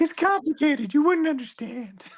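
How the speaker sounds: very high-pitched cartoon character; tiny high character voice; Bitsy voice. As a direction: put on a high squeaky little voice. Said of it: "a second to do Bitsy".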